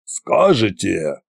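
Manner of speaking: slow, deliberate correction; measured, authoritative restart; correcting. A narrator's reading. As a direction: take over firmly as the tsar. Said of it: "поправил Кощей".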